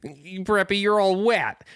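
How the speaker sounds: high, hammed-up TV teen line reading; overacted, high-pitched sitcom taunt; badly delivered line. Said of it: "he says it just like that".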